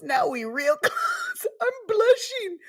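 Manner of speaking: high, squeaky voice breaking with laughter; crying with laughter. Real, not acted. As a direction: squeal it through tears of laughter. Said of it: "I'm crying".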